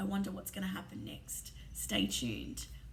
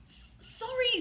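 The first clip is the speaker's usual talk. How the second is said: higher, slow, broken stammer of a cry; sobbing apology; 12 semitones higher, slower than usual. A narrator's reading. Hagrid apologising to McGonagall between sobs, higher broken stammer. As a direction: choke the word out through tears. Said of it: "sobbed Hagrid".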